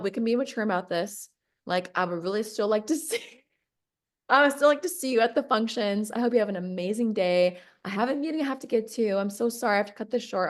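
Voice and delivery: fast, hurried excuses to get off the phone; rushed brush-off. Retold or imitated. The leader, rushing to end the call, speaks fast. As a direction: rattle it off like you're desperate to hang up.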